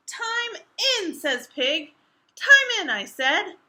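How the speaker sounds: high, loud insisting shout; Pig shouting. Acted.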